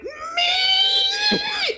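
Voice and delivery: very high, stretched-out squeal; screeched shout. Acted. Carol hit in the arm mid-shout, screeched cry. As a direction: carry the scream on as the word warps. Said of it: "She's like".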